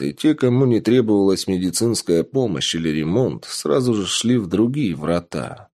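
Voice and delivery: usual speaking voice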